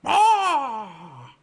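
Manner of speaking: high, loud drawn-out bird cry; piercing mock eagle call; eagle screech. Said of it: "what eagle noise does an eagle make".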